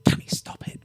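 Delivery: urgent hushed whisper into the earpiece; a whispered rebuke down the earpiece rig. Acted. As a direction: hiss it low over the comms.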